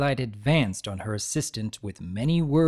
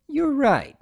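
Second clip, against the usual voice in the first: suppressed amusement; 14 semitones higher, 4 dB louder than usual. Acted. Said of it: "failing to hold back her amusement".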